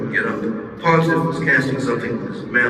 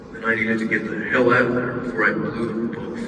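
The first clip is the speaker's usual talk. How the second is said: usual speaking voice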